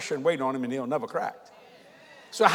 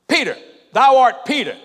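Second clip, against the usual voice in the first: PETER called out high, then repeated after a pause; "thou art Peter" with a loud, high "thou"; emphatic address to Peter; 4 semitones higher, 7 dB louder than usual. Retold or imitated. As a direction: hit "Peter" hard, like singling him out. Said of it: "He said it like this".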